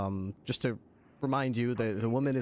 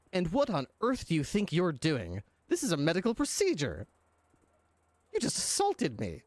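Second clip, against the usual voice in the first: higher, indignant scoff; 6 semitones higher than usual. Acted. Clown doctor scoffs at the cop who shoved him, higher and indignant. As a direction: scoff at him, offended and superior. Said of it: "he looks at you and scoffs and says".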